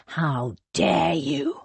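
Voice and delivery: slow, menacing outrage; indignant fury. A narrator's reading. Thomas turning on Cornelius, slow and outraged. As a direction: draw it out with low anger. Thomas confronts his cousin.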